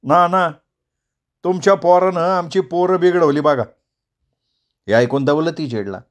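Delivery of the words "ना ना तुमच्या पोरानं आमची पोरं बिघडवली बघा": raised, heated accusing voice; angry accusation. Acted. Villager Savla angrily blames Daulati for spoiling his son, heated. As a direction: glare and throw the blame at him. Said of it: "भुवया चढवून, डोळे वटारून".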